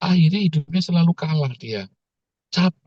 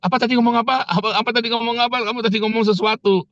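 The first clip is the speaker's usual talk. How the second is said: high-pitched, insistent questioning; acted-out impatient begging for an answer; worked-up prodding; 9 semitones higher, faster than usual. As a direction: pester eagerly, pitched well up. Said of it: "Saya emosi, soalnya emosinya nggak tahan".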